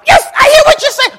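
put-on a terrified boy shouting back to make it stop; scared child. Preacher as a child crying out to his angry daddy, high and loud. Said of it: "And I'm like".

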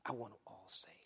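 hushed, breathless repeat; whispered God. Retold or imitated. Preacher whispering God's wish to save all. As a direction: whisper it intimately. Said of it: "He says".

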